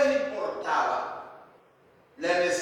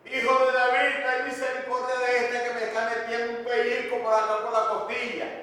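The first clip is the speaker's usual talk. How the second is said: higher, rushed mock complaint; queja fingida; 5 semitones higher, faster than usual. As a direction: parody a prayer turned into petty griping. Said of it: "Él no decía". (queja fingida, aguda y atropellada)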